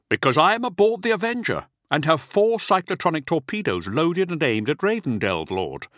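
high, forceful declaration; defiant officer. A narrator's reading. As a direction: announce it high and firm to your lord. Bran confesses threat over comms.

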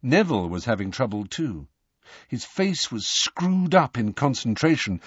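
ordinary delivery

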